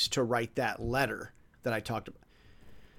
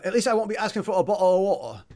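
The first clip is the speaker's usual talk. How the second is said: quick, lightly put-on Cockney accent; bad Cockney; 5 semitones higher, 5 dB louder, faster than usual. Joking that he could do the podcast in a rough Cockney accent. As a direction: drop the t's, a hint of Cockney. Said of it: "bad Cockney I'm using right now".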